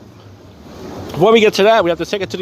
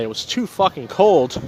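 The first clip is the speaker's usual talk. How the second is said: ordinary talk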